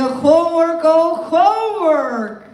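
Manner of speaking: theatrical title announcement. Pretend. Host announces a favourite poem to the audience, theatrical. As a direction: proclaim it with mock drama, stretched out.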